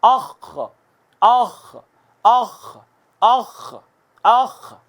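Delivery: اخ with a heavy throaty خ; throat sound demo. Shown as a demonstration. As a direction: say اخ from the top of the throat, heavy and thick. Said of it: "میرے بات کہیے گا".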